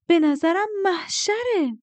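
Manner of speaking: high, loud, drawn-out delight; excited, too loud. A narrator's reading. Girl blurts approval of the amplifier plan, excited.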